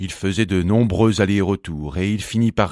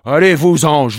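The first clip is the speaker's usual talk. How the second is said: loud, raised, impatient voice; irritated dismissal; 5 semitones higher, 8 dB louder than usual. A narrator's reading. Grumpy bumblebee sending the bee away, loud and irritated. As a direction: shoo them off crossly and loudly.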